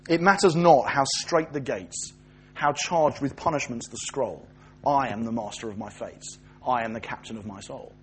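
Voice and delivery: slow, measured verse, sinking low and soft; poem recitation. Acted. Preacher quoting Henley's poem from memory, measured recitation.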